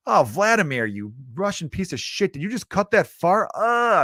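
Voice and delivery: high-pitched comic complaint at a crewmate; mock disgusted outrage. Passenger scolds Vladimir for farting in the sub, mock outrage. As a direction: groan in exaggerated disgust. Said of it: "the very last thing was like".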